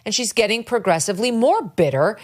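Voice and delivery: ordinary delivery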